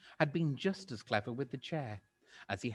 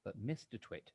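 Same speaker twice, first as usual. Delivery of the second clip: ordinary delivery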